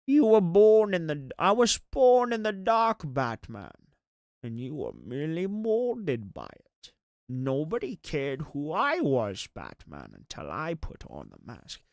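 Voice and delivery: a hammed-up Bane monologue. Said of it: "I think the Bane voice".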